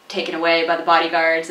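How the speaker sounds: ordinary talk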